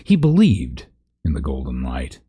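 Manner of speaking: ordinary delivery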